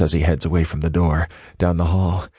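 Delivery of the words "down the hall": usual speaking voice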